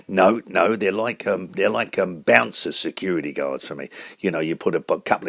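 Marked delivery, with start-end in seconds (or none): none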